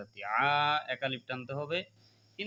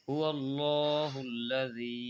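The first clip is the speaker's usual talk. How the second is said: slow Quran recitation of হুয়াল্লাহুল্লাজি; much slower than usual. Shown as a demonstration. Teacher asking students to repeat after him, slow recitation. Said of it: "আমার সাথে বলেন".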